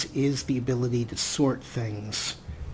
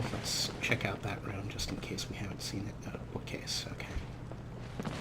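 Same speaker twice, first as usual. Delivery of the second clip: hushed unvoiced whisper aside; 4 semitones lower, 11 dB quieter than usual. Real, not acted. Gamer whispering while checking a room. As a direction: breathe it as a secret aside.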